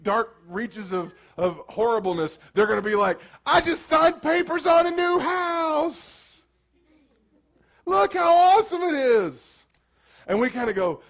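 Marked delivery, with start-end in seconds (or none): 3.5-9.6 high, slow show-off boast; mock proud homeowner; bragging neighbor. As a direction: gush about the new house to rub it in. Neighbor bragging about new house.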